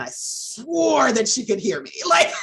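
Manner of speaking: loud, high, tipping into laughter; laughing exclamation. Real, not acted. Mother recalling hiding her crying from her daughter, laughing. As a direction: exclaim it loudly as a laugh takes over.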